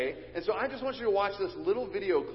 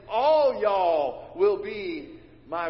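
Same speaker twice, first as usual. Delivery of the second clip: louder, slow, drawled "All y'all will be my witnesses"; preacher hamming up a Southern drawl; bold Southern; 2 semitones higher, 7 dB louder, much slower than usual. Shown as a demonstration. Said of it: "so bold and so Southern".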